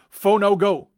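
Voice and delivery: loud, high rival chant; imitated shout. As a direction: shout it like a heckling car fan. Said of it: "would shout".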